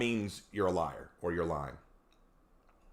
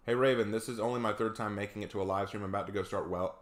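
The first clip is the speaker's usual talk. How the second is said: ordinary talk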